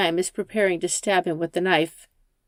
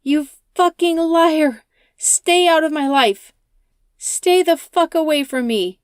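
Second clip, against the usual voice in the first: higher, much louder furious shout; 9 semitones higher, 6 dB louder than usual. Acted. Rachel shouts at the driver as she stabs him. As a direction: scream her out of your life. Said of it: "She shouts".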